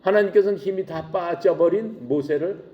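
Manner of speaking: usual speaking voice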